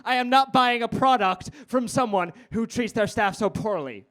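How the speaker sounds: high, indignant mock voice; annoying teenager. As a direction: imitate a self-righteous teen making a declaration. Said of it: "this is how everyone sounds that winds me up".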